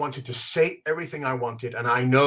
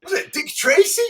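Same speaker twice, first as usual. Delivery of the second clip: laughing; 5 dB louder than usual. Retold or imitated. We were laughing.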